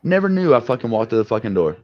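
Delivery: usual speaking voice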